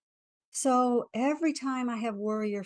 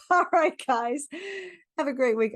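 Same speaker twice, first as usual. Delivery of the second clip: laughing; 7 semitones higher, 8 dB louder than usual. Real, not acted. Laughs, bursts back to energy.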